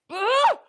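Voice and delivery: a high, loud grossed-out ugh; disgusted ugh. Real, not acted. Reacting to the thick caterpillar with a high disgusted ugh. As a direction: recoil with a sharp ugh of disgust.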